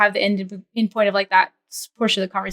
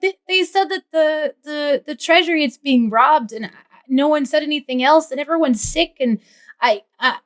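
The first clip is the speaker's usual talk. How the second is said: higher-pitched, stammering excuse; at a loss for words; 6 semitones higher than usual. Acted. Rico explains the intruders to his colleagues, flustered and halting. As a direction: fumble for words under questioning. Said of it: "at a loss for words".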